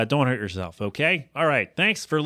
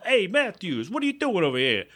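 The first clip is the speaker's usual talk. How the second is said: Mister Turner impression; 5 semitones higher than usual. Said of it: "Mister Turner and his first episode of Boy Meets World".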